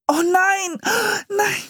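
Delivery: very high, wailing scream; horrified cry. A narrator's reading. The siblings see the turkey go up in flames, horrified. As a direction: shriek in dismay, pitch shooting up. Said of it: "schrien die Geschwister entsetzt".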